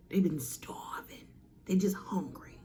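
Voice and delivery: hushed dramatic whisper. Acted. Whispering to the audience about starving Titans. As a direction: drop to a breathy hush for effect.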